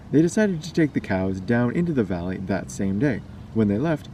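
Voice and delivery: usual speaking voice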